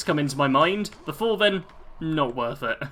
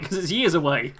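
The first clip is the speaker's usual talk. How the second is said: ordinary talk, nothing marked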